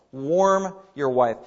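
"warm your wife" drawn out very slowly; slow, deliberate "warm your wife"; slow emphasis. Shown as a demonstration. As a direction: linger on each word of "warm your wife". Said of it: "can so be translated".

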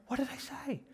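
high, soft, baffled question; baffled protest. Acted. Acting out a texting misunderstanding.

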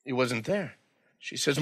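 ordinary talk

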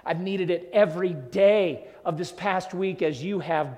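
ordinary talk, nothing marked

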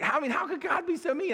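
high-pitched mock protest; indignant objector. Listener challenging the preacher over a mean God, mock-indignant. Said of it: "So you say".

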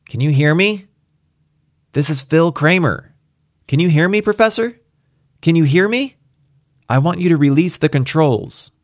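high, insistent radio hail; urgent Kramer calling out. Acted. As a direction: call out to someone who may not hear. Kramer calls the Professor.

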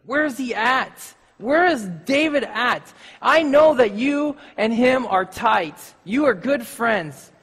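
Saul angry. Retold or imitated. Saul glares at Jonathan across the table, slow angry demand. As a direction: draw out each question like an angry king. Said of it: "Saul was mad".

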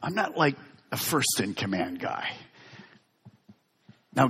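normal talking voice

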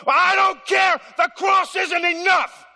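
loud, high defiant outburst at God; defiant shout. Acted. Stubborn sinner shouting at God, loud and defiant. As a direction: yell it back in defiance.

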